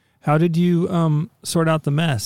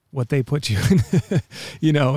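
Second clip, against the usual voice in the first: rueful laughing; 2 semitones higher than usual. Real, not acted. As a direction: laugh breaking in at the end. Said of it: "I'm not laughing 'cause it's funny".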